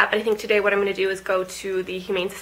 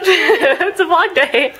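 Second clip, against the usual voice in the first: sing-song; 7 semitones higher, 4 dB louder, much slower than usual. Real, not acted.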